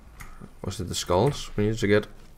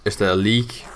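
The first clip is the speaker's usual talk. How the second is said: ordinary delivery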